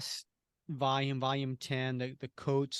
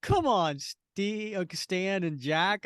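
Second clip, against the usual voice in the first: higher, louder exasperated "come on"; exasperated complaint; 5 semitones higher, 3 dB louder, much slower than usual. Retold or imitated. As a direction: groan at the writers in mock frustration. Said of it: "And I was like".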